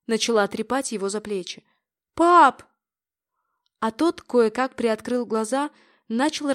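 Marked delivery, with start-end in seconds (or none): 2.1-2.6 громкий высокий зов; дозваться отца; отчаянный окрик. A narrator's reading. Пытается привести отца в чувство. (дочь зовёт отца в чувство)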